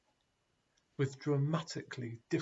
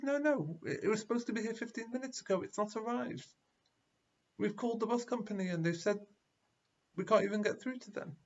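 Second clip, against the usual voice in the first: much higher, fast anxious protest; agitated crowd; 7 semitones higher than usual. Retold or imitated. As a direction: rush it out in a panic.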